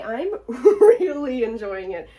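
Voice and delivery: loud, slow, laughing gush. Real, not acted. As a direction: laugh through it, delighted.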